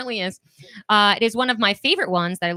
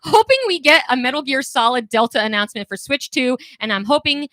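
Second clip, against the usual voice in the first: loud, high, eager comment reading; pleading excitedly, louder and higher; hammed-up excitement; 6 semitones higher, 6 dB louder than usual. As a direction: read it out like an overexcited fan. Hammed-up reread of off-topic comment.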